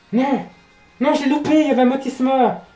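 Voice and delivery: high, loud cry of dismay; dismayed shout. Real, not acted. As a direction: yell in frustration. Missed a rare Pokémon.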